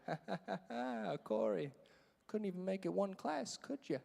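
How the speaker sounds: soft, amused principal chuckling through the taunt; gloating laughter. Retold or imitated. Principal to student back after one class, gloating laughter. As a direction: imitate a quiet, mocking laugh. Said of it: "starts laughing again".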